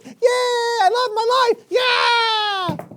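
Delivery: very high squeaky elated voice; joyful Moses. Retold or imitated. Uncle voicing Moses celebrating freedom, giddy. Moses rejoicing after crossing.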